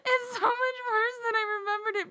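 high squeal through laughter; helpless laughter. Real, not acted. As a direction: squeak the words out while cracking up.

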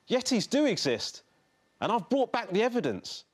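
put-on high, eager young voice; triumphant boy. A narrator's reading. Henry telling his father he has proof, triumphant. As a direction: declare it with excited pride.

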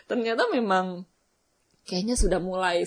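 ordinary delivery

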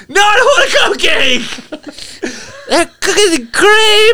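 put-on high, laughing drunk voice; imitating the drunk friend offering cupcakes. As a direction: giggle it like a tipsy friend. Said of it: "hilarious when she's drunk".

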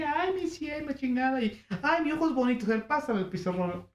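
a hint of a sweet old teacher. Old teacher voiced fondly.

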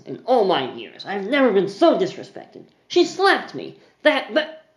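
a blustering offended patron; indignant gentleman. Acted. Mister Harbin protesting to Charlotte, indignant and high. Offended man complaining to barkeep.

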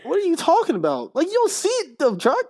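high, incredulous bro; can't believe she doesn't see it; retold exasperation, pitched high; exasperated disbelief.

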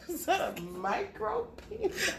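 high, slow, laughing disbelief; mock shock. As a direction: gasp it out in mock horror.

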